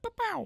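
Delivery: high mock pow pow; gunshot sounds. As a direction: fire off two quick shots with your mouth. Hacker shoots Diego.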